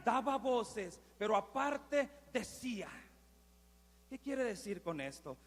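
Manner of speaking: ordinary talk, nothing marked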